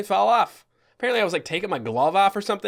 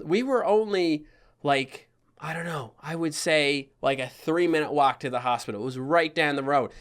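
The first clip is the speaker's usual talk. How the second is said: ordinary talk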